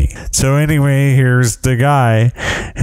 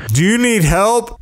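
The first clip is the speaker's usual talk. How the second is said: mock yell; 10 semitones higher than usual. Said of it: "This dude yells at, yells out".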